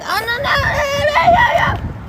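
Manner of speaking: hammed-up crying shout; mock wail. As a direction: wail it out like someone hurt. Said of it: "you're like".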